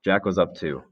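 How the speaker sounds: usual speaking voice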